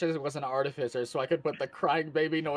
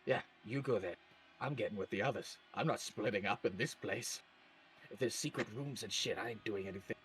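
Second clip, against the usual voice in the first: low grumble; 6 semitones lower, 12 dB quieter than usual. Acted. Character refuses to split up.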